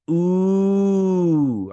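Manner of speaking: long, high, loud "ooh"; impressed ooh. Retold or imitated. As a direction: draw out a rising-and-falling ooh of intrigue. Retelling own reaction to a reveal.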